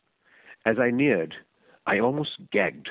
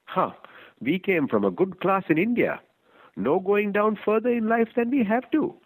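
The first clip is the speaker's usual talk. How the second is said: higher, haughty proud wife; wife's voice; 11 semitones higher than usual. Acted. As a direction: voice the snobbish wife looking down her nose.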